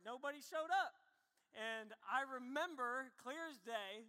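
usual speaking voice

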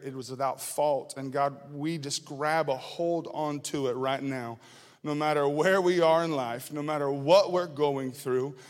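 low, earnest prayer voice. Acted. Pastor praying before the sermon, low and earnest.

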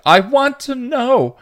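slow, loud, very high; angry yell. A narrator's reading. Dawn yells back at Buffy, angrily. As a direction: yell it back, stretching every word. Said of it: "yelled back angrily".

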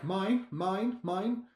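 high, nagging seagull cry; seagull squawk. Retold or imitated. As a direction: squawk it like a greedy gull. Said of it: "You've just said they're like".

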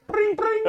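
high, loud bring bring; phone ring imitation. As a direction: chirp it like a ringing phone. Phone ring bit again.

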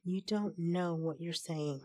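usual speaking voice

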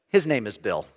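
low, resigned correction; eye-rolling wife. Retold or imitated. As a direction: imitate a wife setting it straight with an eye-roll. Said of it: "who's rolling her eyes at this point".

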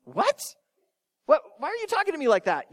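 high-pitched, baffled disbelief; acted shock. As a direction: react as if thrown by unexpected kindness. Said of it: "You're gonna shock 'em".

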